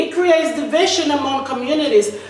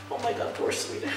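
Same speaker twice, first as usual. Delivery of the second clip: mock-pitying whisper, much softer; sarcastic whisper; 3 semitones lower, 14 dB quieter than usual. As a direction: breathe it out with dry sarcasm. Sarcastic aside about poor Sweden.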